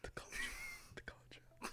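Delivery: hushed mock whisper. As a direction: breathe it out in a whisper. Mock hushed repeat after hang-up.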